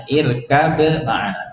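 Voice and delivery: "Irkab ma'ana" very slow, b bounced before the m; izhar, clear b. Shown as a demonstration. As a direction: separate b from m in "Irkab ma'ana", slowly. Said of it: "ini adalah izhar ya". ("Irkab ma'ana" pelan, ba memantul jelas)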